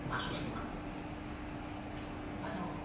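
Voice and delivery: whispered aside; hushed voice for entering heaven. Acted. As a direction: breathe it out like a secret.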